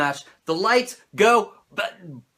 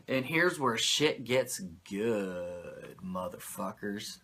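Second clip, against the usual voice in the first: hushed drama; 12 semitones lower, 12 dB quieter, slower than usual. Acted. Dramatic build to wrestling story.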